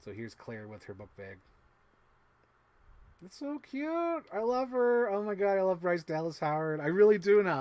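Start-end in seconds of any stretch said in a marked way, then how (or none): 3.2-7.6 excited gushing. Real, not acted. Admiring the finished figure.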